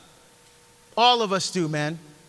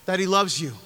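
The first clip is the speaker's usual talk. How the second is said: ordinary talk, nothing marked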